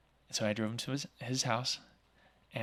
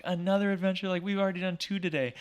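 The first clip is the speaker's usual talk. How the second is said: much higher mock-exasperated wife; wife's disbelief; 8 semitones higher, 4 dB louder than usual. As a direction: imitate the wife's incredulous protest, raised up high. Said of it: "My wife's like".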